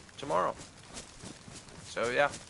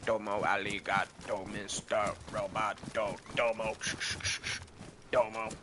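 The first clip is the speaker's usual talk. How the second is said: robot voice; 4 semitones lower than usual. Retold or imitated. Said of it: "he's got this little robot voice changer".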